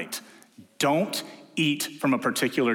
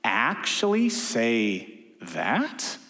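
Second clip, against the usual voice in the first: slow, drawn-out doubting serpent; sly serpent; much slower than usual. Retold or imitated. Serpent questioning the woman, sly and drawn out. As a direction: plant the doubt slowly. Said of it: "He just says".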